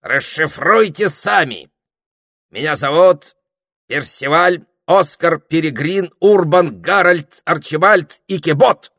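loud, high, slow roll call; grand announcement. A narrator's reading. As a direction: proclaim each name with pomp. Parrot grandly announces his long name.

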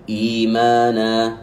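إيمانا with the long i held two beats; slow recitation. Shown as a demonstration. Teacher pointing to the word, slow recitation. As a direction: chant إيمانا slowly, holding the long i. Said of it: "انظر إلى الكلمة هذه".

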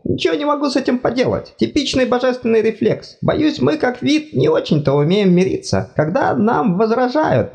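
high fussy god voice, apologetic; embarrassed god. Acted. God explains reflex.

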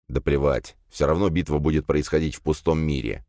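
ordinary delivery, nothing marked

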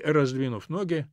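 plain reading voice

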